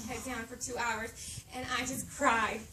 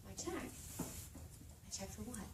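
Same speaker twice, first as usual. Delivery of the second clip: stunned whisper; 3 semitones lower, 16 dB quieter, slower than usual. Retold or imitated. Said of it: "And I'm like".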